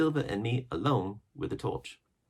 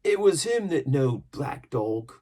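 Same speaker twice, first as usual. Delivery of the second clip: loud, slow, higher pirate voice; gruff pirate; 9 semitones higher, 8 dB louder, slower than usual. A narrator's reading. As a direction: growl it out like a sea dog.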